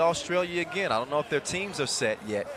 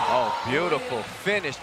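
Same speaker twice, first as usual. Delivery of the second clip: normal talking voice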